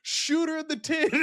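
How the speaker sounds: high-pitched imitated crowd yell; mock scream. Someone in the audience turns on her, mock scream. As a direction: screech it like a panicked onlooker, played for laughs. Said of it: "someone screams".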